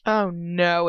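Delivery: normal talking voice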